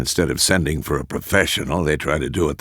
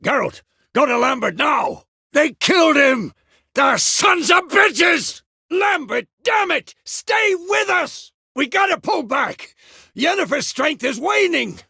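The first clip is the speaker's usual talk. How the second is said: urgent commands yelled over combat; battle shouting. Acted. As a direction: shout desperately to your comrades. Shouting orders during a battle.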